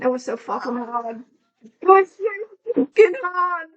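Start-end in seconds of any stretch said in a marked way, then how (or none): 1.6-3.7 high, loud put-on English accent. Shown as a demonstration. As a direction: exclaim it in a hammed-up English accent. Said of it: "talking in an English accent".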